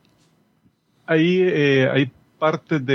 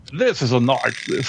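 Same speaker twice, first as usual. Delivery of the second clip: put-on Australian accent; much slower than usual. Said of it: "No lo hizo con acento australiano".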